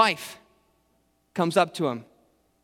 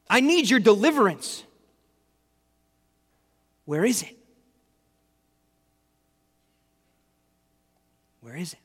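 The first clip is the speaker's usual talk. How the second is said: desperate plea fading to hush; 5 semitones higher than usual. Acted. Job cries out to God.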